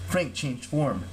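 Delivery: ordinary delivery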